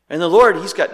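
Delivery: usual speaking voice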